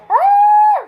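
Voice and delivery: high sharp yelp; pained yelp from spice. Real, not acted. Burning from spicy noodles.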